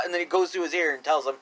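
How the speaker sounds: usual speaking voice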